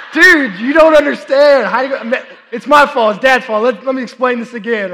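amused disbelief at his son; dad laughing at his son's failed hiding; laughing exasperation. Retold or imitated.

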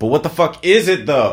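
loud, high, fed-up demand; exasperated outburst. Real, not acted. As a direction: blurt it out in frustration. Baffled that Dust is unexplained.

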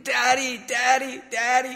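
high-pitched imitation of a little boy calling out; urgent child calling. Young son calls his father from bed, imitated urgent high call. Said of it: "calling my name urgently".

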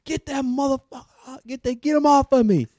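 high, shrill-sounding mock scream of a hysterical woman; imitating her screaming. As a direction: squeal it like the panicked young patient. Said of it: "she's screaming".

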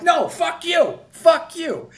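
very high, very loud shouted curse; furious yell. Retold or imitated. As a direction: top him, scream it back. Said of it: "And I'm like".

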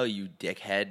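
ordinary delivery

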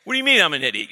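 outraged mock protest; man offended at being called an idiot; offended retort. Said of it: "That's how men talk".

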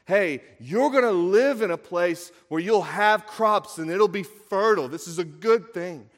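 loud, high preacher emphasis; impassioned preaching. Acted. Preacher explaining a blessing to the congregation, impassioned. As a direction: drive the good news home with force.

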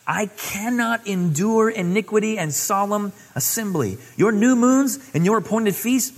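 preaching God's anger at full force; stern divine rebuke. Acted. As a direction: thunder God's complaint.

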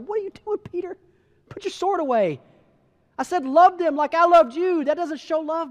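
high-pitched, exasperated rebuke; retold scolding. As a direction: scold a friend who went too far. Jesus rebukes Peter in retelling.

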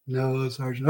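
barely enough breath left to refuse; weak, drained reply. Retold or imitated. Parched trainee answering the instructor offering beer, drained and soft.

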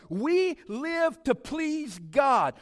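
loud, high and slow, every word weighted; preached proclamation. Acted. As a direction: proclaim it to the congregation. Preached climax before whispered echo.

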